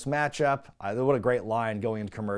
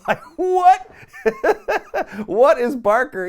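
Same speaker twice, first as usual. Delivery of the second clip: high, loud laughing through the words; laughing disbelief; 11 semitones higher, 8 dB louder than usual. Real, not acted. Host laughs at Barker's spanking threat, real laughter. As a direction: crack up in disbelief. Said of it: "laughing at Barker's line".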